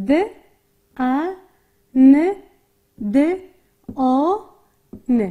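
د، ا، ن، د، آ، ن each alone with a pause after it; sound by sound. Shown as a demonstration. Teacher sounding out the word before blending, د، ا، ن، د، آ، ن separately. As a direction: spell out the sounds د، ا، ن، د، آ، ن one at a time. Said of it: "یک با هم ترکیب شوند".

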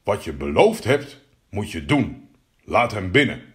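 low voice of a father king; stern king. Acted. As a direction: lay down the rule as a king, in short firm phrases. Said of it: "Toen zei de koning".

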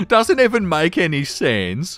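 usual speaking voice

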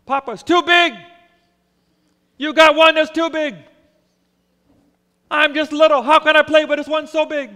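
put-on loud, high whining little boy; a spoiled child protesting loudly; whiny child. Boy complaining car too big.

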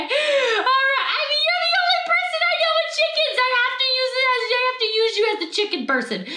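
high, hurried, half-laughing protest; squealy amused self-defence. Real, not acted. Amused defence of teasing friend as example.

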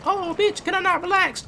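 very high, squealing complaint; startled outrage. Real, not acted. Gamer surprised by a tank, high startled whine. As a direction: yelp it out, caught off guard.

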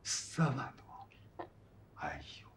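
hushed, slow, breath-only disbelief; stunned. Acted. Husband hears what his wife spent, stunned near-whisper. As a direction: let the shock take the voice away.

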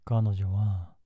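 ordinary delivery

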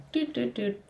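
high, soft little doot doot; humming walking tune. Retold or imitated. Hums walking through the lobby, softly. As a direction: hum a jaunty walking beat. Walking sound effect in story.

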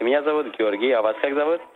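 ordinary delivery

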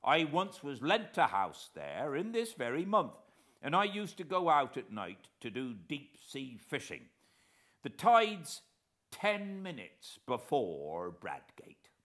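lightly voiced naval veteran, eager to help; retired officer answering gentlemen politely; old coast guard man. A narrator's reading.

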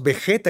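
Vegeta said be-JE-ta, with raspy Spanish j; Spanish J sound. Shown as a demonstration. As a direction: read the g of Vegeta as in Gerardo. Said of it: "la G E sin U es Vegeta".

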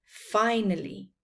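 usual speaking voice

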